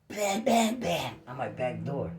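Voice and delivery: ordinary delivery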